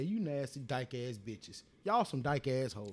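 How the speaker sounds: low, slow insulting sneer; hammed-up disgusted put-down; mock disgust. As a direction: drag out the insult with contempt. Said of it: "I say".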